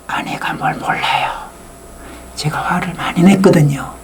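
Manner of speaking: 비밀 말하듯 속삭이기; 아내 몰래 털어놓듯 숨소리로; 속삭임. Real, not acted. 청중에게 비밀 고백. (청중에게 몰래 고백하는 남편, 속삭임)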